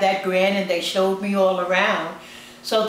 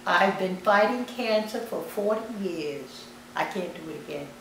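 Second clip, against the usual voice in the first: retold weary resignation; 5 dB quieter than usual. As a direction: quietly quote a worn-out patient giving up. Retold dying patient giving up.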